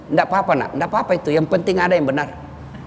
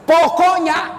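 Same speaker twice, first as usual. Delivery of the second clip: wife's stubborn shout; 11 semitones higher, much slower than usual. Retold or imitated. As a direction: bark it like a wife who won't listen. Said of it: "kalau dia bilang". (istri berteriak keras menutup perdebatan)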